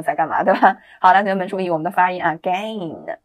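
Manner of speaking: normal talking voice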